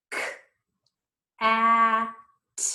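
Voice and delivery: "K, A, T" as three separate sounds, the A held long; sounded out. Shown as a demonstration. Teacher leads children through sounds in cat, "K, A, T" sounded out one by one. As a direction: segment "K, A, T" sound by sound with gaps. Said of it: "Let's say the word together".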